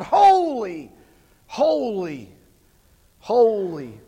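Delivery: loud, high call of holy, fading; angels crying out. Acted. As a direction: cry out each holy like the angels. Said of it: "angels crying out".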